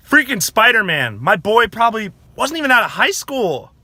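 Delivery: high, loud cry of outrage; upset outcry. Real, not acted. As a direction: exclaim in loud, high-pitched disbelief. Lamenting Spider-Man's death in the movie.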